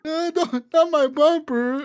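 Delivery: very high, drawn-out mock lament; mock whining protest. Imitated driver pleading as cop bangs on the bumper, mock whine. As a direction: whine it like a helpless man, hammed up.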